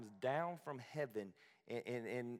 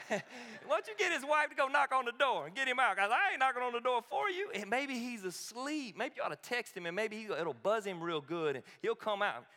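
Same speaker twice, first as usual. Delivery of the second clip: high-pitched Southern heckling; Southern smack talk; 8 semitones higher, 4 dB louder than usual. Acted. Elijah mocking the absent god, Southern smack talk. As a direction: needle them loudly in a Southern accent. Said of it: "smack comes out better in a southern accent".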